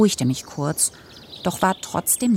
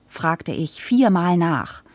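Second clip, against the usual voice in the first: usual speaking voice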